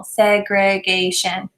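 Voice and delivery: "segregation" slowly in separate chunks: seg-re-ga-tion; said in syllable chunks. Shown as a demonstration. Teacher chunking the word for students, slow and deliberate. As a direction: chunk "segregation" slowly so they can place dots. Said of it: "Repeat the multisyllabic word to students in syllable chunks".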